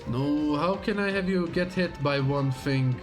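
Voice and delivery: ordinary talk, nothing marked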